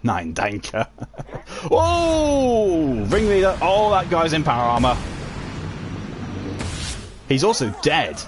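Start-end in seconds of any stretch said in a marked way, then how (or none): none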